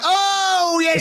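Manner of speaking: high cheeky pantomime reply, laughing; panto shout back. Retold or imitated. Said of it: "one of my mates shouted back".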